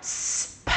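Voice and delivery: long hiss then a popped p; hissing s, then a quick lip pop; alternating soft s and popped p; s p drill. Shown as a demonstration. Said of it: "One more".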